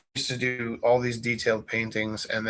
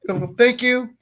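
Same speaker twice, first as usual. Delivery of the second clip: mock gratitude; 7 semitones higher than usual. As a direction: overdo the gratitude with a wink. Teacher's mock praise of honesty.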